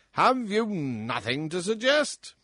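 loud, high, bullying king voice; roaring tyrant king. Acted. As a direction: boom like a spoiled drunken tyrant. King pressing the dwarf.

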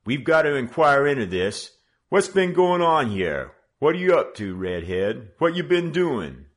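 loud, high bullying questions; menacing hoodlum leader grilling the boys; tough gang leader. Acted. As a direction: lean on them like a street boss.

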